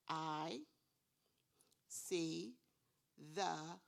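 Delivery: I see the dog with long pauses between words; word-by-word reading. Shown as a demonstration. Teacher modelling word counting with counters, pausing. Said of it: "put a counter into the cup for each word".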